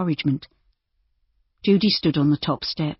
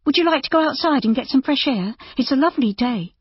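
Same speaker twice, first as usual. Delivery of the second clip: put-on lightly higher young lady; young woman voice; 9 semitones higher than usual. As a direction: voice Judy bright and kind. Narrator voices Judy.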